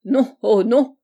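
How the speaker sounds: loud, hasty denial; very alarmed. A narrator's reading. Host placating the furious angel, very alarmed. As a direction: blurt it out to placate him. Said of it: "très alarmé".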